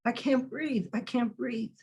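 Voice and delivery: breathless, quiet plea; hushed gasping plea. Acted. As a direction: gasp it out as if smothered. Quoting victim being smothered.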